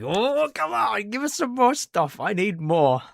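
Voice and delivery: whiny begging fan. Pretend. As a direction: beg like a desperate fan. Fan begging for Dead Rising news.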